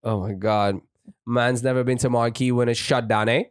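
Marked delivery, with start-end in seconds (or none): 1.2-3.4 put-on [name]'s Jamaican accent. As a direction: toss it off like [name] doing Jamaican by the pool. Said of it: "he loves to do his Jamaican accent".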